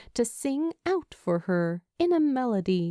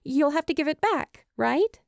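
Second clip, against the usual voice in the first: higher and fast, flustered backpedal; hasty stammer; 6 semitones higher than usual. Acted. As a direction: rush it out, tripping over the words. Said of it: "she stammers a bit when she hastily adds".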